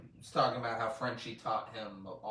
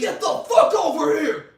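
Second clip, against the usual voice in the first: screaming, much higher and louder; furious shout; 14 dB louder than usual. Retold or imitated. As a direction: bellow it down the hallway. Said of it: "And he's screaming at her".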